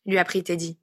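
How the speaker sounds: plain reading voice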